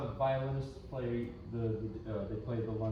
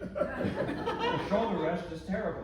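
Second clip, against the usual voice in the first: retold laughing reply; 6 semitones higher, 4 dB louder than usual. Old teacher laughing as he agrees about the shoulder rest. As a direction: chuckle while agreeing.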